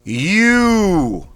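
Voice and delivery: You punched out high and loud in one breath; thrust out sharply. Shown as a demonstration. Coach drilling warm-up, sharp loud thrust-out You. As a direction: dump all the air on You. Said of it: "Thrust out quickly, sharply".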